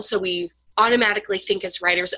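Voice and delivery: usual speaking voice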